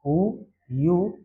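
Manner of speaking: उ, यू stretched very slowly and softly; drawn-out vowel demo. Shown as a demonstration. Teacher to pupils, slowly stretching उ, यू. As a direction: hold out उ, then यू, as a slow model. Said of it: "यू के उच्चारण में क्या क्या निकल सकता है?".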